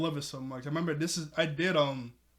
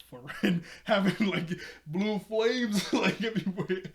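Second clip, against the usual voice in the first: louder, higher, broken by laughter; laughing retelling; 5 semitones higher, 7 dB louder than usual. Retold or imitated. Yozu demanding an explanation for blue flames, laughing retelling. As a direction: act out the complaint while cracking up. Laughs while acting out complaint.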